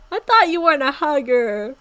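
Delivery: suddenly loud, amused cry; loud outburst. Real, not acted. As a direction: blurt it out loud at the page. Reading own old story, reacts.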